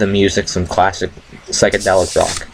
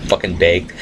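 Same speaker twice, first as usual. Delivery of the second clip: normal talking voice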